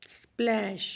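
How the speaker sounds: स्प्लैश said alone, softly, with short "a"; short vowel demonstrated. Shown as a demonstration. Teacher showing a closed syllable, स्प्लैश with short vowel sound. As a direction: model the short "a" inside स्प्लैश. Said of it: "short sound आ रही है".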